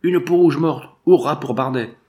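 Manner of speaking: high, loud triumphant cry; sudden shout. A narrator's reading. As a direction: shout the news out to the others. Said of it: "une exclamation soudaine et une voix cria".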